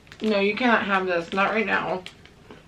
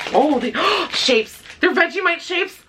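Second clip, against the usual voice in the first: delighted gasp; 9 semitones higher than usual. Real, not acted. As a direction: gasp at the snack you love. Finding Vegemite snacks.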